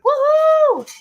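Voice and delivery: high loud woohoo; cheering. Real, not acted. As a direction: whoop it up. Cheering loudly.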